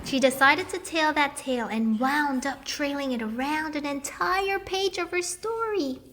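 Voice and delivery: plain reading voice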